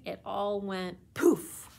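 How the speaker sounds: ordinary delivery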